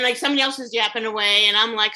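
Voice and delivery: ordinary talk, nothing marked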